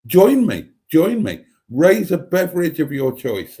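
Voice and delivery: hearty loud invitation; rally the room to drink; calling out cheerily. Real, not acted. Calls viewers to toast.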